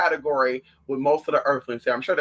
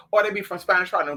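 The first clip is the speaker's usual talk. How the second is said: ordinary talk, nothing marked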